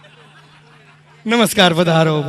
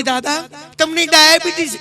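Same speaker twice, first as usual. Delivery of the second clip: doctor; 7 semitones higher than usual. Retold or imitated. Doctor gives diagnosis.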